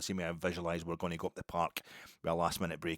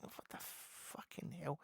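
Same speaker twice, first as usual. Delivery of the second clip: exasperated disbelief; 9 semitones higher, 11 dB quieter, much slower than usual. Retold or imitated. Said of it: "I'm like".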